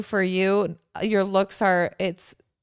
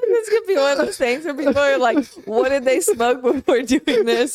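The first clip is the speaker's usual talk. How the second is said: laughing; 5 semitones higher, 4 dB louder than usual. Real, not acted. Laughing while talking.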